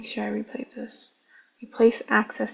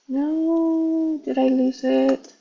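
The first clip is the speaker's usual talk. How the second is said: sudden higher, louder alarm; surprised; 5 semitones higher, 6 dB louder, much slower than usual. Real, not acted. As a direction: caught off guard, no, did I. Pasted token seems gone.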